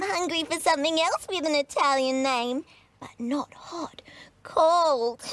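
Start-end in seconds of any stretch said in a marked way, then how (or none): 3.0-4.0 secret little whisper. Acted. Teasing hint about gelato. 4.4-5.2 loud punchline. Acted. Punchline after the whisper.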